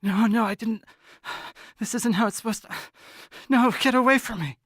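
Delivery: high, frantic protest with sharp breaths; urgent muttering. Acted. Game master as thrashing Elle, urgent and frantic. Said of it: "kind of faster and more urgently".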